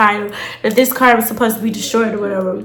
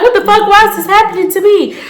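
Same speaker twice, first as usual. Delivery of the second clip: shocked outburst; 8 semitones higher, faster than usual. Retold or imitated. Retelling her reaction to the scam, in shocked high disbelief. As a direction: cry out at the unfair luck. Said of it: "So, I'm like".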